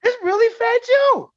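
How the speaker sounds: giddy high yell of disbelief; excited disbelief. Retold or imitated. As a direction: squeal like a starstruck fan. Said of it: "I was like".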